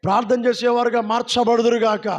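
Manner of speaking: usual speaking voice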